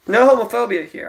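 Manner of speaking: normal talking voice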